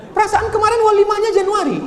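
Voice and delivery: very high, quick squeal of puzzlement; exaggerated high-pitched query; comic disbelief. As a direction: squeak it out fast in mock astonishment. Acted puzzlement at early aqiqah invitation.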